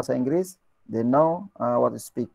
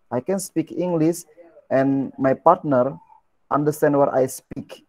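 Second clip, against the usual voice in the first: Indian speaker's confidence; slower than usual. Retold or imitated. Teacher voicing an Indian speaker's thoughts: slow confident. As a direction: sound sure of yourself, slowly. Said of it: "mereka hanya berpikir".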